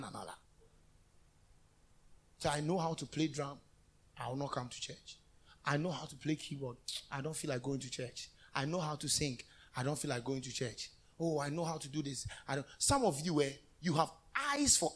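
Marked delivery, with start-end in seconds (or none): 2.4-12.7 low, subdued mimicry of a half-interested member; reluctant excuses. Preacher mimics members who skip church, reluctant excuses. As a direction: mumble it lower, unenthused, as an imitation.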